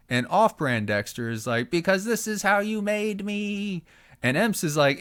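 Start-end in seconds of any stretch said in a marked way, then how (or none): 1.7-3.8 very slow, much higher, eerie whisper-thin voice; creepy voice. Acted. Son tells his father of visions, creepy and slow. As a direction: drag each word out like a haunted seer. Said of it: "Why are you so fucking creepy".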